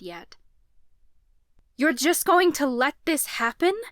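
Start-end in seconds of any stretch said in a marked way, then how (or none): 1.8-3.8 loud, high outraged demand. A narrator's reading. Nadine confronting the priestesses, loud outrage. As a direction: demand an answer. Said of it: "I demanded".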